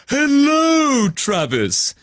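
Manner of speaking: high, gentle unicorn greeting; kindly, calm. Acted. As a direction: greet him kindly and calmly. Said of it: "in a very kindly but calm voice".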